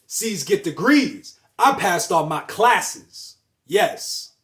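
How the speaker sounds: loud, slow proud affirmation; proud declaration. Shown as a demonstration. Speaker rejecting "but" with a loud proud claim. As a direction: declare it like a victory.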